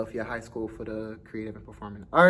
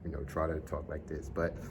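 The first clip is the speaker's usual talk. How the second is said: put-on deep voice; 6 semitones lower, 7 dB quieter than usual. Shown as a demonstration. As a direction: noticeably lower voice, forced down. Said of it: "I try to put on a deep voice".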